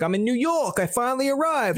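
mock excited tourist. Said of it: "the guy's like".

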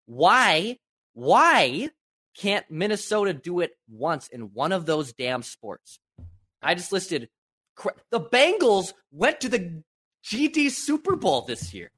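high, pleading outrage, slowing on the complaint; exasperated rant. Real, not acted. Fan venting about Minnesota sports.

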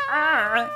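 loud startled ah; mock scared gasp. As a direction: gasp out in fright.

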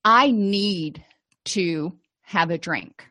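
slow, deliberate, with pauses between phrases; believing it fully. Shown as a demonstration. Therapist models a negative thought for an exercise, slow and believing. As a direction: weigh each word as if you believe it. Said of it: "believing it as much as you can".

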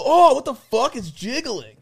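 high, disgusted reenacted cry; mock horrified exclamation; grossed out. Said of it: "And we both go".

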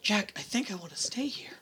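very soft whisper; barely voiced, hesitant admission; hushed confession. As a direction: whisper it to your partner. Solemn confession to companion.

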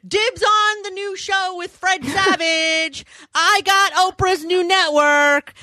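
high, slow, smug exec voice; mock executive voice. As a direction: play a greedy ad exec. Advertisers voiced at upfront.

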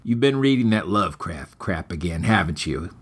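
low, gruff grumble from a groggy stepfather; groggy stepfather scolding his stepson, low; low, weary stepfather voice. A narrator's reading.

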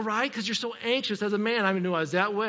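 ordinary delivery

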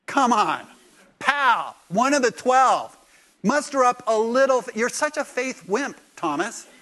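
Jesus needling Thomas, hammed-up exasperation; scolding. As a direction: tease him as a faith wimp. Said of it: "he's gonna go".

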